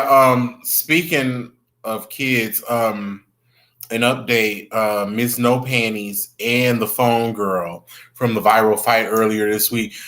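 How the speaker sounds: ordinary delivery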